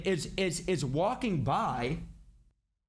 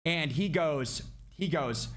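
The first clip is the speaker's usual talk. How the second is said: usual speaking voice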